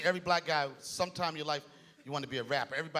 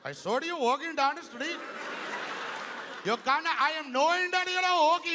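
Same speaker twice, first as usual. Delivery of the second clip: put-on Indian accent; 10 semitones higher than usual. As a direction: sing the rock song in a hammed-up Indian accent. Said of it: "That same song with an Indian accent".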